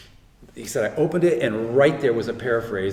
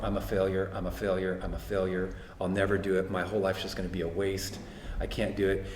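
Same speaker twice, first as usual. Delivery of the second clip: low, soft retelling of despair; retold despair; 4 semitones lower, 6 dB quieter than usual. Son saying over and over he's a failure, understated despair. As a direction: repeat it low and subdued, a touch of hopelessness. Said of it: "I just started sobbing, crying".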